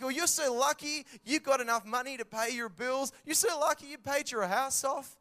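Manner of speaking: high, loud sing-song envy; mock envious. Onlooker to lucky parents, mocking and loud. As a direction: mimic the jealous onlooker.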